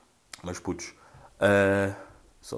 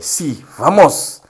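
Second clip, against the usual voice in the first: slow, louder hammed-up Spanish rallying cry; mock inspirational Spanish; 5 semitones higher, 7 dB louder, much slower than usual. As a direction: lightly ham up a motivational Spanish cheer. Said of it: "escreveu assim no, no story". (grito motivacional espanhol trocista)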